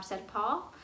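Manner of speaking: usual speaking voice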